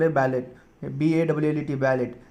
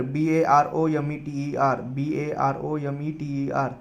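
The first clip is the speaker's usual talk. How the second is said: slow spelling; slower than usual. Shown as a demonstration. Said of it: "spelling word slowly".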